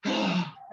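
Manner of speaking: long releasing sigh; big sigh. Shown as a demonstration. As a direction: let the breath go with a sigh. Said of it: "Big old sigh".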